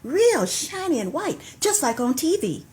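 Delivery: rhythmic poem recital with cues; poetry call and response. Acted. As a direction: perform the poem, leaving room for the response.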